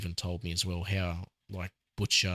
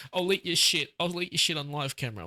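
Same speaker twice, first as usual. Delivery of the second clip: high-pitched imitation of desperate pleading; hammed-up high plea; mock Homelander begging; 10 semitones higher than usual. Quoting Homelander going too far, high mock begging. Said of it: "where he's like".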